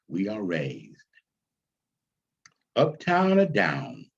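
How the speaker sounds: lifted, measured poem reading, stretching key lines; poem recital. Acted. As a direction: recite it like a credo to the audience. Recites the play's prologue poem.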